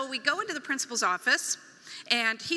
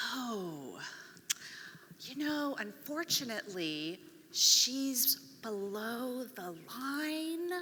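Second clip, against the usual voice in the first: principal's very slow, drawn-out voice, softer; slow, long syllables; 5 dB quieter, much slower than usual. Retold or imitated. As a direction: stretch every syllable like the principal. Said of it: "she's speaking ... very slow and long".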